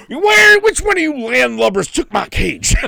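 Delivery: high, loud hammed-up pirate yell. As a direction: bellow like a pirate after his cage. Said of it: "They're like".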